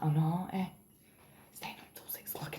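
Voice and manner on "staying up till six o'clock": hushed confiding whisper; whispering an aside to the camera. Real, not acted. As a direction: whisper it like a secret.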